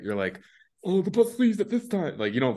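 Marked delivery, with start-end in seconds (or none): 0.8-2.2 much higher, airy mock voice of a naive private; mock clueless private. Young private imitated in a much higher mock voice. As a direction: play the oblivious young soldier, light and silly.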